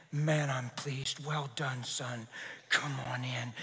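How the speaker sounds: tender father's welcome. Retold or imitated. God welcoming his son home, low, soft and tender. God's voice in sermon story.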